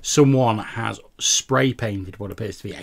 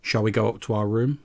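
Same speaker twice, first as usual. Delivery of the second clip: ordinary talk, nothing marked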